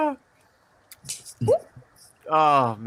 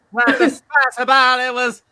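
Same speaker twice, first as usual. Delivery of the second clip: mock Italian accent; 7 semitones higher, 4 dB louder than usual. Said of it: "I can't do an Italian accent".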